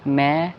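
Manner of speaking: "मैं" slowly with open ae; "मैं" stretched with the wider vowel; big ai vowel. Shown as a demonstration. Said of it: "बड़ी ये".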